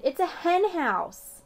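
high, slow show-off answer; eager answer. A narrator's reading. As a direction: drag it out proudly. Roger answering excitedly.

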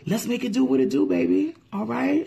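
high, fast playful hype; hyped up. Real, not acted. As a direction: fire them up, quick and high.

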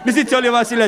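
ordinary delivery

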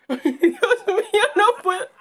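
loud, high, drawn-out cry; panicked wail. Real, not acted. Player panics in her hiding place, high wail. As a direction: wail in panic, nearly laughing.

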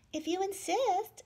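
sweet, high and slow; sweetly. Acted. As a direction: say it kindly and sweetly. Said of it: "answered the friendly ladybug sweetly".

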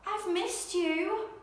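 tender. Acted. Bear reunites with his friend.

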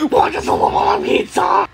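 high, hoarse cartoon wail; rejected character's high-pitched tantrum; upset shouting. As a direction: shout it high in wounded outrage. Pizza offer is refused.

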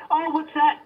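ordinary talk, nothing marked

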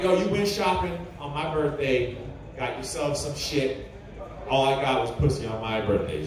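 low, grumbling complaint; understated arguing, dropping low and soft; retold argument. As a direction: air the grievance in a sinking voice. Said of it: "we're laying there arguing".

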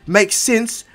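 loud, high retort. Retold or imitated. Izuku's reply mid-punch.

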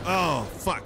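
sudden high, loud yelp; startled curse. Real, not acted. Player caught by an attacker in a game, startled curse. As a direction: curse out loud as if just hit.

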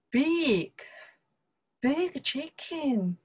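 high, drawn-out imitation of impressed friends. Chinese friends telling her about America's chicken, imitated impressed. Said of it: "They say ... I hear, or maybe I saw".

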